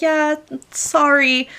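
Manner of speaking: high, drawn-out guilty apology; reflexively apologetic. Acted. She turns down someone interested in her, reflexively apologetic. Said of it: "showing guilt by apologizing".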